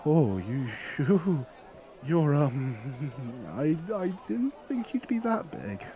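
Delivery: put-on awestruck boy. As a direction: gape up at the giant. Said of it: "slowly looks at him and goes".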